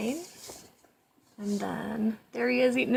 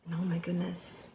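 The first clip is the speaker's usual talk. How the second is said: plain reading voice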